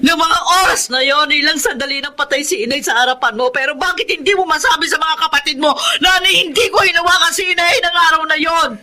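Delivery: crying, shouting her innocence; tearful protest. Acted. Wife crying out to her accuser, shouting through tears. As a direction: sob and shout that she is no killer.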